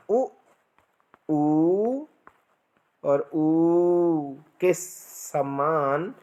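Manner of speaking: उ short, ऊ long, last ऊ drawn out very slowly; short, long, prolonged u. Shown as a demonstration. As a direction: lengthen each vowel in turn. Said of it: "तीन प्रकार के ऊ".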